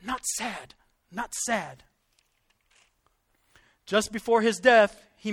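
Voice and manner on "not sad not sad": softly imitating a frail whisper; hushed, with what strength is left; weak dying whisper. Retelling the dying preacher's last protest, in a weak near-whisper. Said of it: "mustered all his strength to whisper".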